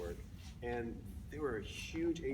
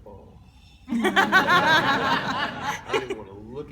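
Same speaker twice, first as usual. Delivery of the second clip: slow, much higher, louder, laughing; laughing groan; 12 semitones higher, 17 dB louder, much slower than usual. Retold or imitated. Hungover self to mirror, laughing and slow.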